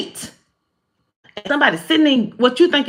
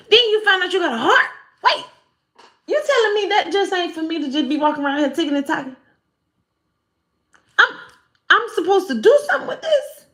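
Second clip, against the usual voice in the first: much higher, amazed realization; astonished; 8 semitones higher than usual. Retold or imitated. Learning about the heart chakra, high amazed voice. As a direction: gasp at the discovery in a high voice.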